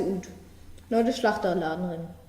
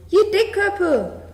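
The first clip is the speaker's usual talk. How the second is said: furious scolding; 4 semitones higher, 5 dB louder than usual. A narrator's reading. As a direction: yell at the frogs till they count. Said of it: "schimpft die Bur". (wütend laut schimpfender Bauer)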